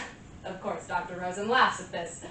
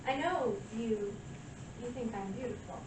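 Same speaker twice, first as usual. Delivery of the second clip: self-assured seduction; 7 dB quieter than usual. Acted. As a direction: play it softly seductive, savouring each pause. Monologue, flirting with listener she claims desires her.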